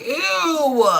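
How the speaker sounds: high, loud mocking ew; mock disgust at the ex. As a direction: squeal it in disgust. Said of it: "And I'm like".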